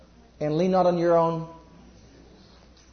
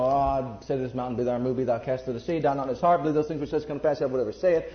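mock half-hearted faith; 6 semitones lower, 5 dB quieter, faster than usual. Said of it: "It's in the head".

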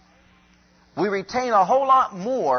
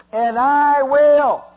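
slow, loud and high on I will; booming emphasis; 7 semitones higher, 8 dB louder, much slower than usual. Acted. Preacher to congregation, slow booming declaration. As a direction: thunder out the promise. Preacher booms God's promise.

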